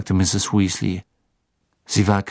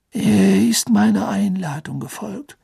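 put-on high, slow, gentle old wizard; lightly voiced kind old headmaster; Dumbledore voice; 10 semitones higher, 5 dB louder than usual. A narrator's reading.

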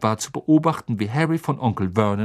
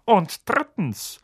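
stern uncle; 6 semitones higher, slower than usual. A narrator's reading. Vernon's third rule.

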